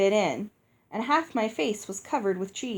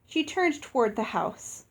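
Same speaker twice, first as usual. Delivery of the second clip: ordinary delivery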